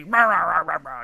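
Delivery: loud mocking blah-blah; loud mock ranting; mock yammering. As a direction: bark it like a bully mouthing off. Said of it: "like, belligerent".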